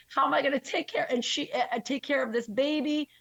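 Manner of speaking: high, tearful retelling of her daughter. Retold or imitated. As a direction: let the voice climb as she breaks down. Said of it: "she was just crying".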